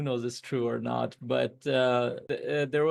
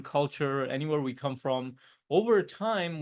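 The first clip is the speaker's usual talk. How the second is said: normal talking voice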